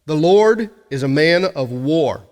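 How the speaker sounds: preacher thundering the climax verse; proclaimed slowly. Acted. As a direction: declare it slowly with full weight. Climax line of scripture reading.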